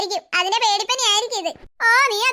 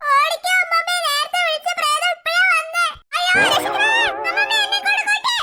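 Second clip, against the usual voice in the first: higher and higher panicked screams for help; panicked screaming; 6 semitones higher than usual. Acted. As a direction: shriek for grandma to save you. Fleeing from the ghost. (പേടിച്ച് അലറിവിളിക്കുന്നു)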